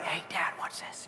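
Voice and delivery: a sly stage whisper to Dad; playful whisper. Pretend. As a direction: whisper it like a secret prank. Jesus aside to his Father.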